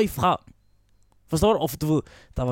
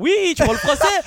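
"Oui, tu parles français" pitched higher in a hammed-up French voice; fake French reply; 9 semitones higher, 3 dB louder, faster than usual. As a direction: bluff it as if you spoke French. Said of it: "Så siger jeg".

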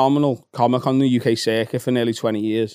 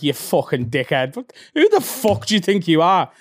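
mates mocking; 4 semitones higher than usual. Mates mocking him after bad gig.

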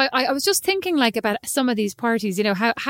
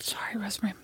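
whispered, much softer apology; mock-sheepish whisper; 3 semitones lower, 11 dB quieter, faster than usual. Real, not acted. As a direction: breathe the apology under your breath. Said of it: "You don't look sorry at all".

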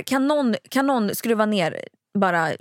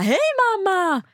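high, drawn-out gleeful greeting; imitating overjoyed child; 10 semitones higher, slower than usual. Mother imitating her son at preschool pickup, high gleeful cheer. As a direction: sing it out like a delighted little kid.

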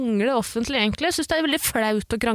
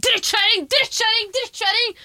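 mock furious yell; 11 semitones higher, much slower than usual. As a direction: spit it out in a rage. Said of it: "og jeg sier".

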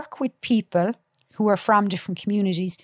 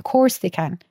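ordinary talk, nothing marked

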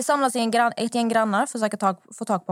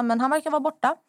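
normal talking voice

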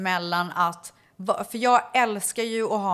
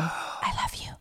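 soft unvoiced whisper of a little girl; 9 dB quieter, slower than usual. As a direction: breathe it like a shy secret. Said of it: "hade hon viskat till honom". (viskat som en liten flicka)

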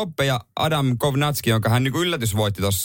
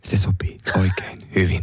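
whisper; 5 semitones lower than usual. Real, not acted. Agrees to stop imitating.

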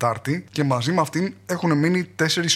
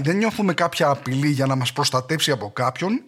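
normal talking voice

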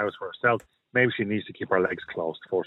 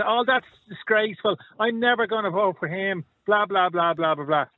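higher, sneering parody of a moaning voter; mock outraged voter; 8 semitones higher than usual. Radio host mocking voters online, higher mock outrage. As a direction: ham up a scandalised member of the public.

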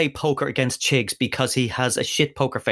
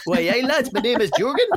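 put-on Geordie accent, higher and bright; Newcastle accent; 9 semitones higher than usual. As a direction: greet the lads in a Geordie accent. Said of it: "some sort of a Newcastle accent".

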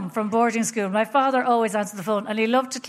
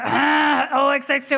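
dad's phone answer; 4 semitones higher, 5 dB louder, slower than usual. Retold or imitated. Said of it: "he said".

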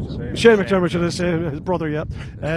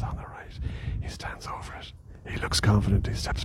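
whispering; 4 semitones lower, 7 dB quieter than usual. Real, not acted. Hushed golf commentary beside green.

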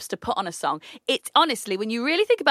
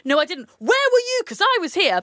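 high, louder defensive retort; mock row; 8 semitones higher, 4 dB louder than usual. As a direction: fire it back mid argument. Acted couple argument.

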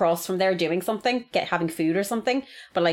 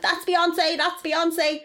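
excited; 10 semitones higher, much slower than usual. Retold or imitated. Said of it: "some girl was like".

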